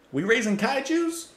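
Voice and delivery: high-pitched mock country accent. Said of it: "Why did I say that in, like, a country accent?".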